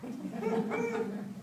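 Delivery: high, hearty belly laugh; Biga's big laugh in a crowded street. Acted. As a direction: let out an infectious laugh. Biga's belly laugh, read aloud.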